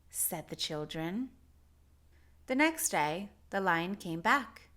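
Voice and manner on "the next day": ordinary delivery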